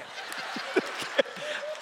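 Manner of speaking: breathy laugh. Real, not acted. Laughs at himself.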